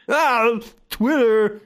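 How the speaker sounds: slow, drawn-out Pauly Shore voice; Pauly Shore impression. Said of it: "That's not how you do it, uh, Pauly Shore".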